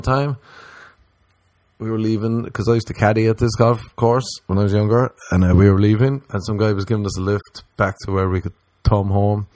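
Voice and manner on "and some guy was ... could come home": ordinary delivery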